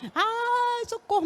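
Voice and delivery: high imitated screams for help; terrified screams. Woman recalling hell, imitating screaming victims. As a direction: echo the screams of the damned. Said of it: "gritos apavorantes".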